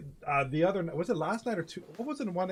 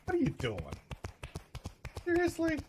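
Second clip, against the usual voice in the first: high disbelieving squeak; incredulous; 12 semitones higher, 3 dB quieter than usual. Real, not acted. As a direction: jump up in disbelief. Incredulous at the video.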